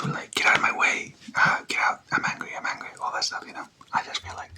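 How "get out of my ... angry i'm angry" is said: ordinary talk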